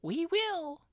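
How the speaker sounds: many mice squeaking their eager agreement together; shrill mouse chorus. A narrator's reading. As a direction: chirp it like a crowd of mice. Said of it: "cried all the mice in a shrill chorus".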